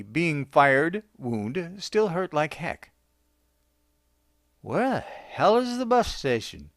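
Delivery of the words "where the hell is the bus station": wondering aloud. A narrator's reading. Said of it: "he wondered aloud".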